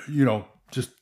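ordinary delivery

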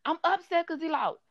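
high whiny mock complaint; imitating the coworker's pouting wail; upset coworker. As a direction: whine it like a sulky grown woman. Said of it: "she's like".

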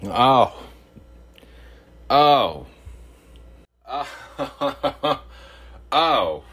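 loud, high surprised oh; hot coffee gasp. Real, not acted. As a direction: gasp at the heat of it. Reacting to hot sip.

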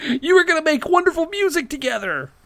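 very high, hammed-up lament; mock heartfelt plea. Co-host joining the nerd's plea to Johnny, mock heartbroken. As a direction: plead melodramatically in a high put-on voice.

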